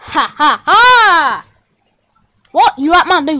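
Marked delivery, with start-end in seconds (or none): 0.0-1.5 slow, separated ha-ha-ha; hammed-up laugh, last ha drawn out; staged laugh. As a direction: laugh it out slowly, deliberately. Villain laughing in a skit.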